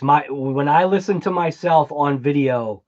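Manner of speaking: normal talking voice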